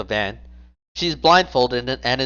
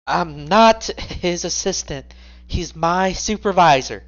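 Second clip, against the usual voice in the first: indignant higher woman voice; 6 semitones higher, slower than usual. Acted. House correcting them indignantly.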